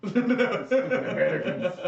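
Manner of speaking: high, slow, laughing echo. Real, not acted. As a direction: repeat it cracking up. Echoing a friend's joke.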